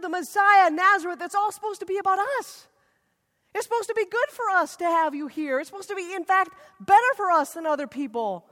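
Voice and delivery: high, loud outraged protest; indignant crowd. Retold or imitated. Hometown crowd protesting to Jesus, high and indignant. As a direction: demand what you were promised.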